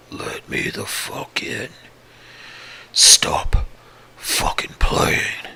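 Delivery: halting, whispered creature voice; halting, weird voice. Acted. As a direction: whisper it unnaturally, like an animal taught to talk. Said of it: "in this halting, weirdly toned voice".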